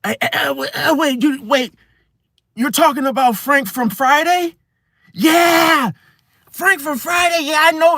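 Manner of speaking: high, pushy cut-in voice; interrupting. Retold or imitated. Interrupter cutting off the speaker, high and pushy. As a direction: barge in and take over. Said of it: "they just interject".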